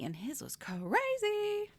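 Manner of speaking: "crazy" drawn out and sent up high; comic exaggeration, slow and high on "crazy"; emphatic crazy. Real, not acted. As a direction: stretch "crazy" into a long, high-pitched exclamation. Exaggerating how wild the brows were.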